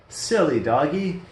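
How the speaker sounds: usual speaking voice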